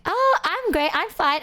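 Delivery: high, breezy mock friend voice; airy ditzy friend. Zeta breezily answers the streamer's questions in a high airy voice. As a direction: toss it off sweet and airy. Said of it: "she's just like".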